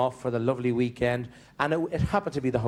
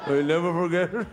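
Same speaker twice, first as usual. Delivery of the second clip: husband's reply; 3 semitones higher than usual. Retold or imitated. Husband Seamus answers.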